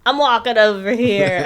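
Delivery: loud, hammed-up New York accent; mock New York accent. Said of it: "New York accent every time".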